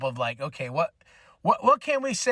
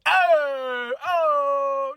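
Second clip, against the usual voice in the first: drawn-out, higher comedian's catchphrase impression; Andrew Dice Clay; 7 semitones higher, 4 dB louder than usual. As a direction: imitate an obnoxious comic's held "oh". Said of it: "Andrew Dice Clay".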